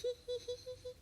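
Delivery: quiet high sneaky giggle; a hushed villain's snicker; sneaky chuckle. Acted. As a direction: snicker softly to yourself like a scheming cat. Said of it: "Tom laughed to himself".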